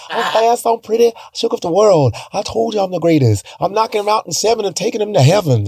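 high, brash boxing boast; Muhammad Ali impression. Boy performing Ali for his uncles, cocky and high. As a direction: show off the Ali voice for cash. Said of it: "Do Muhammad Ali".

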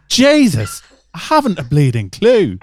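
loud, high mock Irish accent; hammed-up Irish exclamation. Host doing a joke Irish accent for the panel, loud and hammed-up. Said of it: "I'm gonna do the accent".

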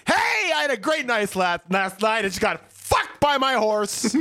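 high-pitched mock announcement; mock bragging shout. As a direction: shout it like a proud public boast. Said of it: "people don't go around going".

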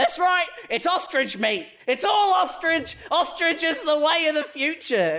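oversell it like a cheesy restaurant owner; mock salesman hype. Restaurant guy hyping ostrich meat, high and excited.

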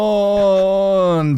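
overacted yell of the dog's name; mock Bo Gullet cry. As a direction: neighbour asking about the dog, a hammed-up call. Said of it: "Calm down, Forrest Whitaker. You're overacting".

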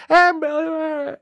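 very high mock stage introduction; imitated high-pitched announcement, half lost in the din; introduction over screaming. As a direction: squeal the name as if under crowd noise. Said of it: "while they're all screaming".

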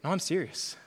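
ordinary talk

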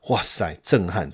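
normal talking voice

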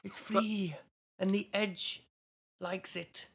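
put-on high, sing-song botched Irish accent. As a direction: let a fake Irish lilt slip into Welsh. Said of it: "I went Welsh then".

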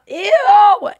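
a high, loud squeal of disgust. Real, not acted. Host grossed out by her dirty Roomba, disgusted squeal. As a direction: recoil from the dirt with a shriek.

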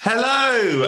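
grand showman greeting; stretched high hello. Acted. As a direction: sing out a long high hello. Grand show intro.

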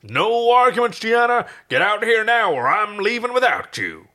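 high, loud order with no room for argument; a captain ordering his crew out now; commanding shout. Acted. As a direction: bark the ultimatum.

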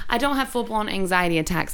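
ordinary talk, nothing marked

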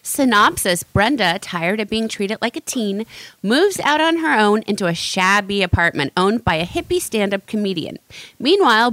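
usual speaking voice

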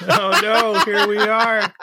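laughing high and bright through the words. Real, not acted. Cohost laughing as his friend tears up again. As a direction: giggle it out, amused.

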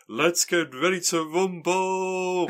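high, slow, drawn-out announcer call; boxing ring announcer. Retold or imitated. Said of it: "ring announcer who's famous for saying".

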